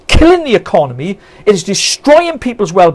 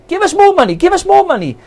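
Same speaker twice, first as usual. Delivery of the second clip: mock begging; 9 semitones higher than usual. Mocking government begging Westminster.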